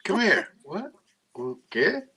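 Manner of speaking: high, puzzled voice of a dog not understanding; confused dog voice. Retold or imitated. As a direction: act out a dog tilting its head, confused. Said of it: "They look at you like".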